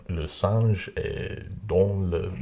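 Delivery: low, slow French, quoting Eddie Izzard; convincing French accent. Retold or imitated. Recalling an Eddie Izzard line in a confident French accent. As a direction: ease into Le Singe est dans le with a French lilt. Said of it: "I would say it convincingly".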